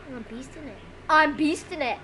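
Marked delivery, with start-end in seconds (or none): 0.1-0.8 very soft, low aside; quiet remark. Real, not acted. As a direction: say it low, almost to yourself. First take, muttered while playing.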